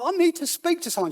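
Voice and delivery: high-pitched, urgent pleading; desperate plea. Retold or imitated. Visitor begging the minister's wife for someone to talk to, desperate. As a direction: plead with a sharp jump in pitch.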